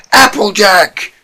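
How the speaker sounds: loud, slow, high call of her name; urgent shout. Acted.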